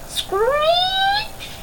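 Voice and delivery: high, long screeching noise; metal screech. Acted. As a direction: imitate squealing metal. Sound effect of metal.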